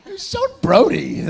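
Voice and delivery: high, slow, drawn-out quoted line; character quote. Retold or imitated.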